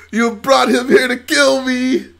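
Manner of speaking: high, slow shouted accusation; acted shout. Streamer acting a prequel line for chat, shouting. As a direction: hurl the line as a furious charge.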